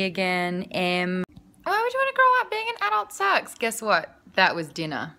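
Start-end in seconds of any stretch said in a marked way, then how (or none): 1.6-3.5 put-on very high, childlike complaint; childish protest. Refusing to grow up and be an adult, childish protest. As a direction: pitch it way up like a kid.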